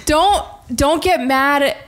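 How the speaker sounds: ordinary talk, nothing marked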